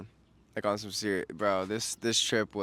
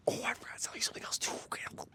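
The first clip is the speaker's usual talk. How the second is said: sudden hushed whisper; 13 dB quieter, much faster than usual. Real, not acted. Vlogger whispering a sudden aside to viewers. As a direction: drop to a quick whisper to the viewers.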